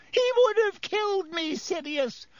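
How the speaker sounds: high-pitched pleading whine; whined. A narrator's reading. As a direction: whine your excuse in a high voice. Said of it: "whined Pettigrew".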